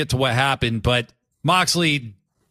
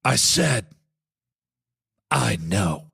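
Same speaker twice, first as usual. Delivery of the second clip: low, fading, calm mock voice; acted Swerve reply; 7 semitones lower, slower than usual. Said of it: "And Swerve goes".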